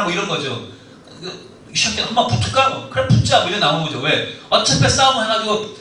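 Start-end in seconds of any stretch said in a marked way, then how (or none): 1.7-2.7 mock fight challenge. 2.9-3.4 much higher and louder dare; hammed-up schoolyard dare; mock fight acceptance.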